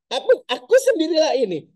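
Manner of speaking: high, loud, exasperated it's me; Jesus insisting to scared disciples, exasperated; exasperated insistence. Acted. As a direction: insist it is really you, fed up.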